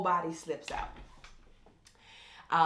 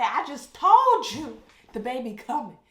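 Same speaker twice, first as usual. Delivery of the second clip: loud, higher, insistent mock shout; retold labor yell; 6 semitones higher, 10 dB louder than usual. To the triage midwife, an exasperated labor shout. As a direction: yell it like the baby's already crowning.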